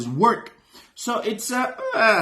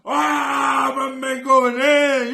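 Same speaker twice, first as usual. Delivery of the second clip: hyped shouting; 6 semitones higher, much slower than usual. Real, not acted. Reactor shouts praise at the rapper mid-track, hyped up. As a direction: shout it like the bar just hit you. Hype reaction to rap verse.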